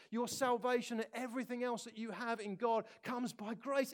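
normal talking voice

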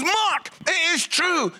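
high, slow, emphatic scolding; angry wife. Retold or imitated. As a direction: imitate a cross wife driving it home. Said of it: "She was angry".

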